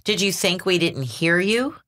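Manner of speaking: ordinary delivery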